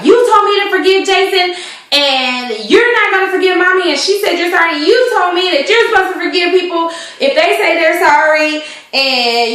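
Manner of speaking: put-on high, insistent child voice; indignant little boy. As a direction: son reminding dad to forgive. Little boy lecturing his father.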